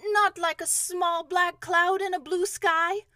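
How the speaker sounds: slow, high, loud worried question; mildly dismayed, drawn-out plea; anxious. A narrator's reading. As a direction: ask it anxiously, calling down. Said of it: "said Pooh anxiously".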